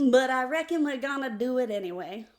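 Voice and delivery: loud folksy Southern twang; mock country Southern sign-off; hammed-up Southern accent. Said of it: "I couldn't keep it straight".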